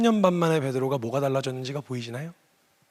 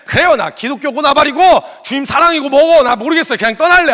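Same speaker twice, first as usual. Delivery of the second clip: much higher, louder, giving up in exasperation; defiant outburst; 11 semitones higher, 11 dB louder than usual. Retold or imitated. Believer telling God he's quitting, loud and exasperated. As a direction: throw it all away in frustration. Imagined walking away from God.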